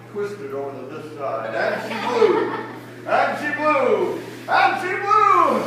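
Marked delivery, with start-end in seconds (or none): 1.6-5.6 slow repeats rising in pitch and volume; mounting, drawn-out huff; building blowing effort. Acted. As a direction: blow harder each time, stretching it out. Storyteller acts out blowing, escalating.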